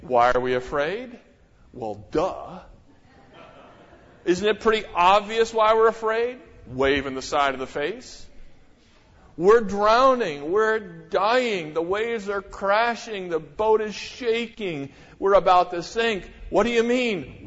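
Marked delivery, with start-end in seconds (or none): 0.0-6.4 lightly voiced cartoon whine; mock Simpsons sarcasm; a hint of a whiny cartoon voice; Simpsons impression. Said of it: "if the Simpsons were here". 9.4-17.4 mock panic. Disciples' panic acted out.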